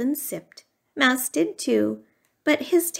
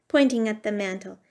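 ordinary delivery, nothing marked

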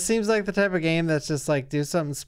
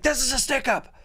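higher, hammed-up robber voice; 9 semitones higher than usual. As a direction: bark it like a stick-up man. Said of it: "that guy be like".

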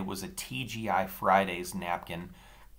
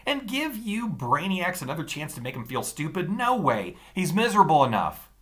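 indignant; 8 semitones higher, 5 dB louder than usual. Acted. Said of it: "Taller Daniel was indignant".

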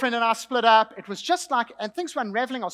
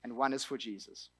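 hushed guide; 8 semitones lower, 9 dB quieter than usual. Acted. Closing a guided visualization.

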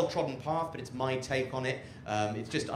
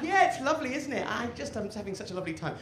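much higher, sweet fussing voice; doting partner; 9 semitones higher, faster than usual. Acted. The cook's partner greeting them home, high and doting. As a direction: coo over them like a loving partner. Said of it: "It's like".

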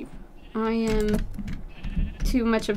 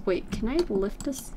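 ordinary delivery

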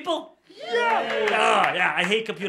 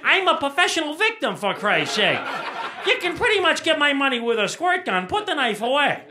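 ranting punchline; 9 semitones higher, 6 dB louder than usual. Real, not acted. Loud self-mocking rant.

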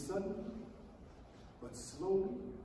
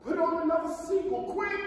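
urgent call; 9 semitones higher, 6 dB louder than usual. Acted. Urgent call for more seagulls.

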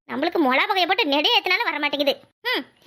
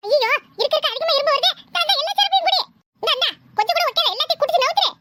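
put-on higher kid voice, insisting; boy forcing grandpa to drink; pushy kid; 7 semitones higher than usual. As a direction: keep shoving the drink at him. Boy forces medicine on grandpa.

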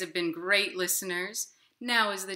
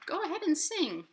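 much higher squeaky little worm voice; tiny inchworm; 12 semitones higher, 3 dB louder than usual. Acted. Inchworm begging the hungry robin not to eat him, high and squeaky. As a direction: plead as a tiny bug. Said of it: "he said".

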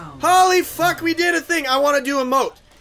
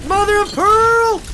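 mock exclamation; 10 semitones higher, 7 dB louder, slower than usual. Real, not acted. Mock exclamation after dying.

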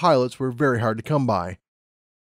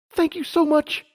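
very high, gushing excited mare; overjoyed thanks. Acted. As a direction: bubble over with joy. Lumina shakes her hoof.

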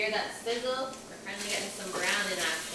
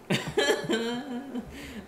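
laugh; 7 semitones higher than usual. Real, not acted. Laughing at chat comment.

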